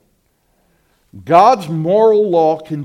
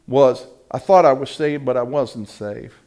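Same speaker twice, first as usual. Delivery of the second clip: ordinary talk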